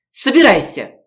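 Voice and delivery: urgent command. Acted. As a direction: order her to pack, now. Gorodetsky urging her to pack.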